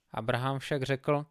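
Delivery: plain reading voice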